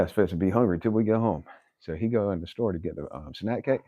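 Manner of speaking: usual speaking voice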